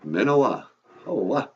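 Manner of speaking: usual speaking voice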